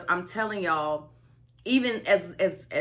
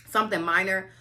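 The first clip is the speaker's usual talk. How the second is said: ordinary talk, nothing marked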